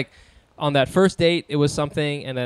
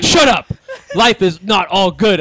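loud mom voice; 3 semitones higher, 6 dB louder, slower than usual. Retold or imitated. Mom scolding in story.

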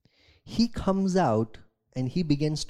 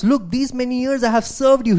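resentful protest; 9 semitones higher, 9 dB louder than usual. Acted. Older son shouts grievance at his father, indignant. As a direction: throw the grievance at him.

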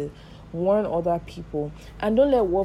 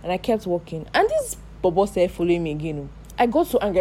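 usual speaking voice

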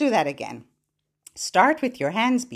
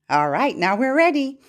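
normal talking voice

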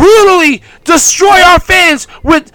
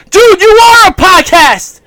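ordinary talk, nothing marked

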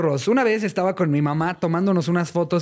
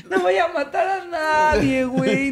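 put-on pleading kid; 10 semitones higher, slower than usual. Acted boy promising.

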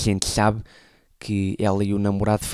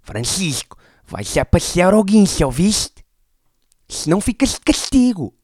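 imitating an angry mother, much higher and louder; mock-scold the son, voice raised high; 9 semitones higher, 6 dB louder than usual. Mother orders her son out to the Guincho, imitated raised voice. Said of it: "a minha mãe levantou a voz e disse".

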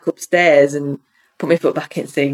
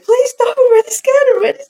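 high, pleading cry; high desperate begging; frightened plea; 4 dB louder than usual. Retold or imitated. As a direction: beg it to stop in terror. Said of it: "saying in my head".

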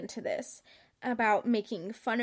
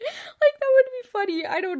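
high, loud laughing talk; cracking up at her own joke; real laughter; 12 semitones higher, 9 dB louder than usual. Real, not acted. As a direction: laugh through the words, much higher.

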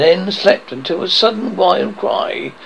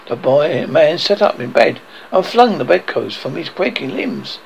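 plain reading voice

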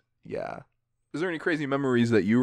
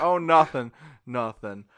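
laughing through words; 2 semitones higher, 7 dB louder, slower than usual. Retold or imitated. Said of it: "we're like".

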